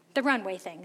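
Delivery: normal talking voice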